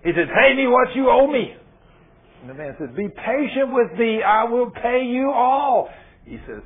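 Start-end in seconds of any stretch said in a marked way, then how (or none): 0.3-1.5 loud, high demand for payment; furious creditor demanding his money; angry demand. Acted. Servant demands debt, grabbing neck. 2.9-5.9 slow, high pleading; begging. Acted. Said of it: "the guy's begging".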